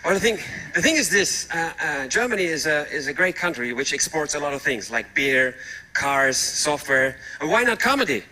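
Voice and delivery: ordinary talk, nothing marked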